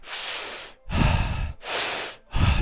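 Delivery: slow, long audible exhale; long breath. Retold or imitated. Being choked and breathing through it, long exhale.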